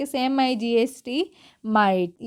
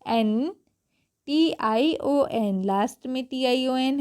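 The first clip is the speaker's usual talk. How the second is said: teacher spells the ending slowly; slow spelling; much slower than usual. Shown as a demonstration. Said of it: "जैसे कि A double T E".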